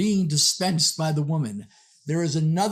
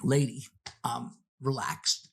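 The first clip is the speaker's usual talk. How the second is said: soothing stranger; 6 semitones lower, 4 dB quieter than usual. Retold or imitated. Calm stranger soothing the woman.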